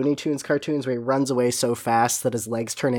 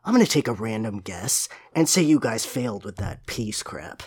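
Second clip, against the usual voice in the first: Shadow, brooding; 7 semitones lower, 5 dB quieter than usual. Acted. Co-host reads Shadow's line about crying Tails, low and brooding. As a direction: mutter it low and gruffly dismissive.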